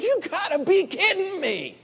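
high, incredulous scoff. Retold or imitated. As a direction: laugh off the idea in disbelief. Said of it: "I said".

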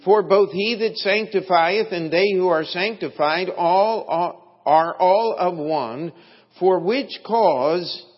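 loud, emphatic pulpit reading; scripture reading. Acted. Preacher reads scripture to the congregation, loud and emphatic. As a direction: read the verse out with building force.

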